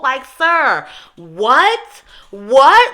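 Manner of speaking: loud, high, incredulous what; outraged disbelief. Real, not acted. Ranting about the careless doctor, loud outraged disbelief.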